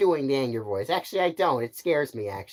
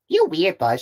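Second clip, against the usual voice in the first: raised, louder jibe; acted teasing character; 6 semitones higher, 5 dB louder than usual. Crewmate teases the space ranger, high mocking voice. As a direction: needle them in a high put-on voice. Second character mocks Buzz.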